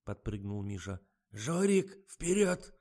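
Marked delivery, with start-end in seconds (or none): 1.3-2.7 high, raised call to go; eager command. A narrator's reading. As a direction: send him off with an urgent order. Misha orders Zhorik to go.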